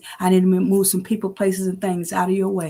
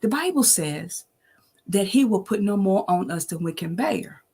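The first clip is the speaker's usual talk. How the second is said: ordinary talk, nothing marked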